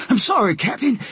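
high-pitched apologetic sailor; puffing sailor. A narrator's reading. Sailor apologizes to captain after clambering down, puffing. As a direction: apologize high, just off the ladder.

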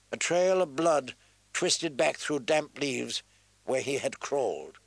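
ordinary delivery

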